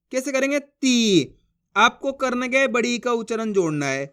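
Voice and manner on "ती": ती held long on the ee; drawn-out vowel. Shown as a demonstration. Teacher repeats the syllable for learners, ती drawn out long. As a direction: drag out the ई of ती. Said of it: "जिस तरीके से मैं खींच खींच के पढ़ रहा हूँ".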